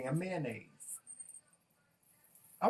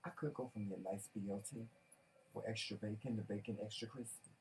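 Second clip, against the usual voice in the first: much lower and softer, dreamy; hushed craving; 7 semitones lower, 13 dB quieter, faster than usual. Real, not acted. Husband daydreams about a crispy BLT, hushed and low. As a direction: murmur it like a craving.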